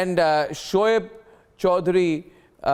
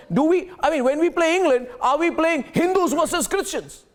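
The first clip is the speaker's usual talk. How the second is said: high, raised, indignant voice; a host yelling in disbelief; outraged shouting; 9 semitones higher than usual. Real, not acted. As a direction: shout your outrage at the fools.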